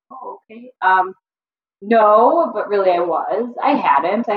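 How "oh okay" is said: much softer, deflated okay; meek small reply. Retold or imitated. Student caught out by her professor, meek and much softer. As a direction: shrink back and concede under your breath.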